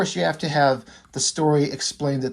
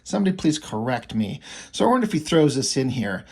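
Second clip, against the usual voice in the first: normal talking voice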